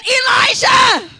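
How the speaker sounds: a widow crying out to the prophet; lightly acted grieving widow. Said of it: "She said".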